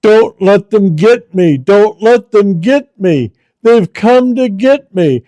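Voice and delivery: retelling a dying woman's terrified scream. Retold or imitated. Pastor voicing the dying great aunt, panicked scream. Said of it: "she started screaming".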